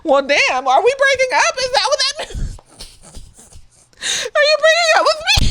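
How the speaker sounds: high, rising, half-laughing; voicing herself asking Dom if he's breaking up; mock hurt question. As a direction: squeak it out, pretending to be wounded. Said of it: "I gotta say shit like".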